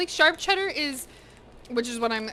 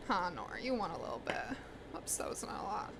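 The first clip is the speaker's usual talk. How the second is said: soft unvoiced whisper to a pet; whisper to the cat; 4 semitones lower, 13 dB quieter, faster than usual. Real, not acted. Whispering to Nora while sharing cheese. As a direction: hush it to the cat.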